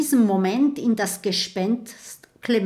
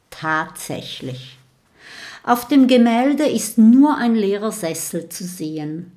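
plain reading voice

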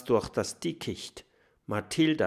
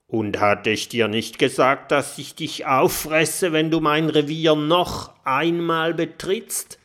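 threatening tiger; 5 semitones higher, 8 dB louder than usual. A narrator's reading. Drohender Tiger.

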